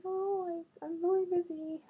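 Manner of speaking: high, loud mock of his excuse; a dismissive guy, hammed up. Mocking the guy's brush-off.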